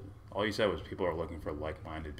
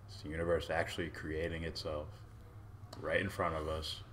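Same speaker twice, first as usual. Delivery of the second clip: normal talking voice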